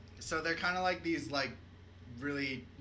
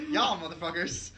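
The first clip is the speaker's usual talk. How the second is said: laughing mock outrage; 2 semitones higher, 6 dB louder than usual. Real, not acted.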